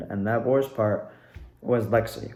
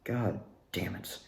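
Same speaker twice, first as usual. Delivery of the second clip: muttered curse; 5 semitones lower, 12 dB quieter than usual. Retold or imitated. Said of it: "I was just like".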